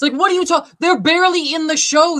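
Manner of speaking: exasperated, loud and high; angry rant. Real, not acted. Ranting about writers' room.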